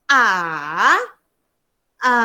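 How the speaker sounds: A dipping, then rising; long scooped A; third tone. Shown as a demonstration. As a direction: drone A down and back up. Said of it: "Turun, lepas tu naik".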